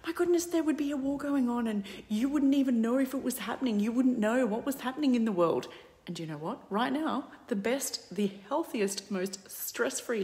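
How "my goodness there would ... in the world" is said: mother's voice. Retold or imitated. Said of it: "My mother used to say to me".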